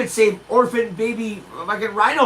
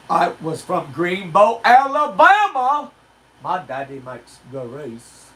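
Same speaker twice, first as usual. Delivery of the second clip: Forrest Gump; slower than usual. Acted. Comic doing impressions for the audience, slow Forrest Gump voice. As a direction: drag it out like a simple Southern storyteller. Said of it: "it's not easy to do Forrest Gump".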